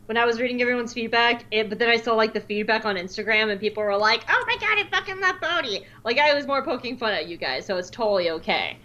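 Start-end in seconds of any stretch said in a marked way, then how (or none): 4.3-5.8 high-pitched imitation of gushing fans; mock fan gushing. Co-host mimicking Instagram commenters, mock gushing. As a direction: squeal it like an overexcited fan.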